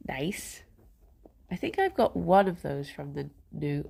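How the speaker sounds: ordinary talk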